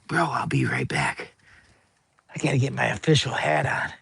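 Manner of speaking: unvoiced whisper to the viewers; whispered aside to the audience. Real, not acted. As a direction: hush it like a secret.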